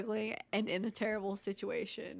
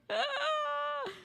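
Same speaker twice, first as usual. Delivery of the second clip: squealing high laugh; 3 dB quieter than usual. Real, not acted. Laughing at story.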